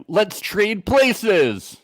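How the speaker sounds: high, drawn-out sing-out; mock eager announcement. Podcaster mocking two men proposing a trade, mock eager. Mock quote of couples swapping.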